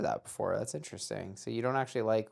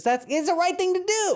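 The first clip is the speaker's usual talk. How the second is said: squeaky high voice; 4 dB louder than usual. Pretend. Mock high voice on principle.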